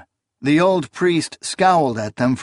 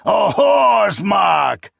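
very slow high shout; furious shout; 8 semitones higher, 5 dB louder, much slower than usual. A narrator's reading. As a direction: scream the accusation. Priest shouting accusation.

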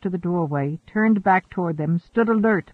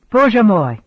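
louder, higher call across the room; raised voice; 4 semitones higher, 8 dB louder than usual. A narrator's reading. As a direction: raise your voice sharply. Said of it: "She raised her voice".

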